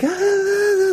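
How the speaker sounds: a big, high, overdone "Ah"; dramatic gasp. Retold or imitated. Mocking soaked tourists with an overdone gasp. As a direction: ham up the shock like a drama queen. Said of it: "being very dramatic about it".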